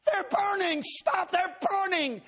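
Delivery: very high, loud shrieks of panic; frantic shrieking; terrified screaming. Retold or imitated. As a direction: scream that they burn. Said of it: "she was screaming at me".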